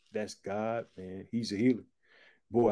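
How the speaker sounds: ordinary talk, nothing marked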